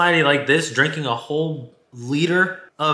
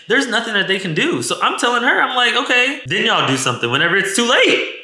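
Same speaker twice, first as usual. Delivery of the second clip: higher-pitched urgent retelling; exasperated plea; 9 semitones higher than usual. Retold or imitated. Woman retelling her plea to the lady cop, exasperated. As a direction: plead the point, pitched up.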